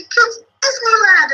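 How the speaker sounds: high, drawn-out playful announcement; parent hyping treat. Pretend. Teacher plays parent announcing Disneyland to kids, hyped up. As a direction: hype it up slowly for the children. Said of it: "And you say".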